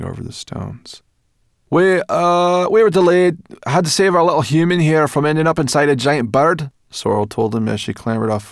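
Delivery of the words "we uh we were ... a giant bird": put-on high, loud, pert brownie girl; brisk forest brownie. A narrator's reading. Sorrel explaining the delay to the professor, pert. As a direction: tell it like it was no big deal.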